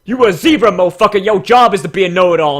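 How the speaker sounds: loud, delighted yell; excited shout. Real, not acted. Reviewer yelling happily at a zebra character, loud excited shout. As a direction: hype him up at full volume.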